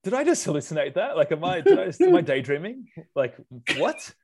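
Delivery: acted-out incredulous panic; stunned disbelief. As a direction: question your own senses, voice rising to a baffled what. Acts out finding the boot empty.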